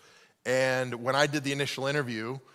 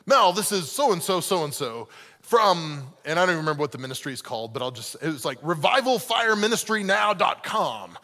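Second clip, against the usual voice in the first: pastor voice; 4 dB louder than usual. Retold or imitated. Caller greeting a stranger in his pastor voice. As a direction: play the smooth pastor selling himself. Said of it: "pastor voice going".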